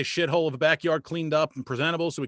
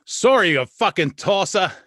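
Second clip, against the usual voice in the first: loud mock Australian insult; hammed-up Australian accent; mock Aussie insult; 4 semitones higher, 8 dB louder than usual. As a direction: fling it like a rowdy Aussie.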